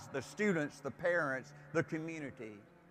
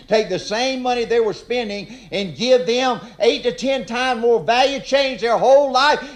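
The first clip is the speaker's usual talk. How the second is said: loud, high outrage building to a peak; indignant shout; 5 semitones higher, 11 dB louder than usual. Real, not acted. Indignant rant about his pay.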